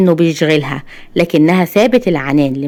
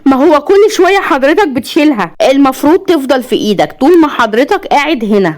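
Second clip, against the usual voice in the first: high-pitched, testy retort; exasperated nurse; 7 semitones higher than usual. A narrator's reading. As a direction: push back at a difficult patient, pitched high. Fed-up nurse answers difficult patient. (بضيق وبصوت عالي الطبقة)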